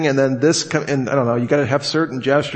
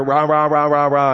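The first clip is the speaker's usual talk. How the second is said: mock monotone ranting. Shown as a demonstration. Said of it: "always up here".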